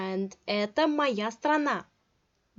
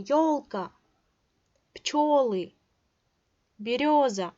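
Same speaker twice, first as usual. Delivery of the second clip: teacher stressing ё in ёлка, пчёлы, берёза; 2 semitones higher, 4 dB louder than usual. Shown as a demonstration. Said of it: "stress would fall on the your sound".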